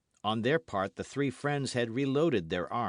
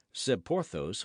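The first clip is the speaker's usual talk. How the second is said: ordinary delivery, nothing marked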